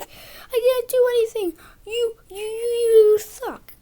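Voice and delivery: high, loud whine breaking into fake sobs; fake-cry it like a brat; mock crying tantrum. Boy mimics Jack crying at them, high whining sobs. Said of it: "he can cry super fast".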